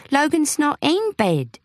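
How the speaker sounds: indignant. Acted. Indignant denial on phone.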